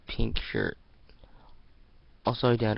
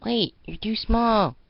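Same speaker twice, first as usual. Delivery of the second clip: high, loud, slow warning cry; high alarmed call; 12 semitones higher, 7 dB louder than usual. Acted. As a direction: call her back in alarm. Sister stops small girl.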